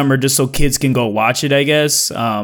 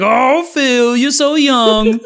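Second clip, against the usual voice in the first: high, slow patronizing coo; mock condescending coworker; 8 semitones higher, much slower than usual. Older manager teasing the youngest on team, mock patronizing. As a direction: talk down to him like a little kid. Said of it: "They'll be like".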